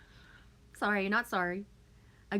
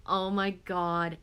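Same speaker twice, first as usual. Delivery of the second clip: usual speaking voice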